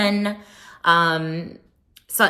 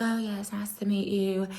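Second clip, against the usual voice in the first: lightly voiced mother; 2 semitones higher, 7 dB quieter than usual. Mother's quiet greeting imitated.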